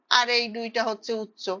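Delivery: usual speaking voice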